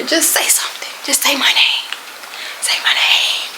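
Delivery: hushed, secretive plea; coaxing whisper. Retold or imitated. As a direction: whisper it, urging the cat. Coaxing cat to talk in secret.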